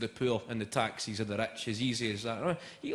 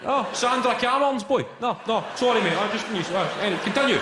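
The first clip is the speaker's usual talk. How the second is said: high, loud, excitable nosy local; eager mock interrogation; 8 semitones higher, 6 dB louder than usual. Comic as Scot quizzing prime minister on his surname, high and excited. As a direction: pester him with eager high-pitched questions.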